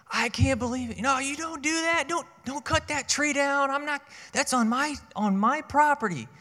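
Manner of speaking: high, loud mock yelling old man; grumpy neighbor yelling. Grumpy old neighbor yelling over the tree line at the narrator. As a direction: play the cranky neighbor shouting about his tree. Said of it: "he came over just a yelling".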